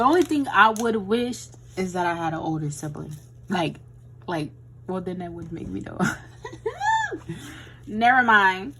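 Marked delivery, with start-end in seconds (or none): 6.0-7.8 sudden high-pitched laugh. Real, not acted. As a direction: burst into a squeaky giggle. Laughs at own slip.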